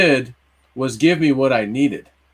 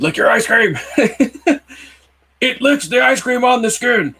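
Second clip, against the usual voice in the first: laughing; 11 semitones higher than usual. Real, not acted. Laughing at the silly dream line.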